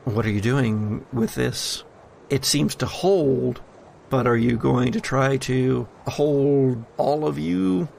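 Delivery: ordinary delivery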